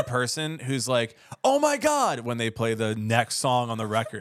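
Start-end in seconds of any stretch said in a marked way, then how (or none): 1.4-2.2 excited fan squeal. Retold or imitated. Fan reacting to next song.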